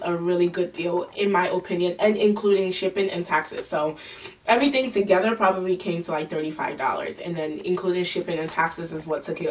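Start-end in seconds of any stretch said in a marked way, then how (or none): none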